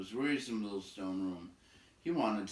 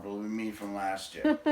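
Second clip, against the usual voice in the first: usual speaking voice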